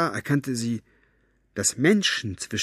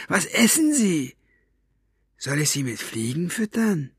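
much higher, nasal-free thug voice, mocking; sneering Buster; 9 semitones higher than usual. A narrator's reading. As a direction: jeer up at the prisoner in a high put-on voice. Buster teases Staubfinger.

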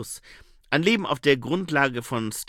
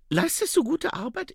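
high female character voice; much higher, questioning the applicant; Saccharissa; 4 dB louder than usual. Acted. (hohe Frauenstimme, fragend)

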